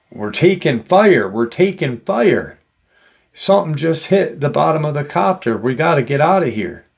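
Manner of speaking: retold panicked yell. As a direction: imitate a pilot raising the alarm, without fully screaming. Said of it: "you hear him start kinda screaming".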